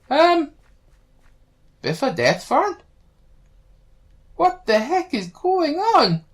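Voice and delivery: high, loud panicked yelling; frantic, voice shooting up. Pretend. Panicked cries in strange place.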